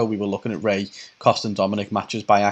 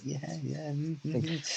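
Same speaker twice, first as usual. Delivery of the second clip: mock creepy, hushed little hums; proper creep; 4 semitones higher, 12 dB quieter than usual. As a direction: mumble approval like a creep. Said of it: "looks like a proper fucking creep".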